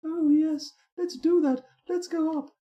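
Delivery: very high falsetto delight; hammed-up high sweetheart voice; imagined fortress dweller. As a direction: squeal with mock rapture until it turns to retching. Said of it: "That's what it's like".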